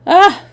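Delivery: high loud stage gasp; startled cry. Acted. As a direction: cry out as if tripping. Annette stumbles, cries out.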